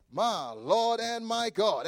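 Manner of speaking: normal talking voice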